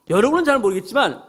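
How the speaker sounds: ordinary talk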